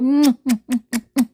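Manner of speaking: loud burst of giggling; loud delighted laugh. Real, not acted. Laugh after cat greeting.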